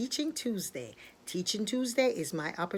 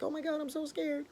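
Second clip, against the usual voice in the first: high little frightened voice; scared bird; 8 semitones higher, 3 dB quieter than usual. Acted. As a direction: voice a frightened pigeon, lightly. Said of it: "what the bird was going through".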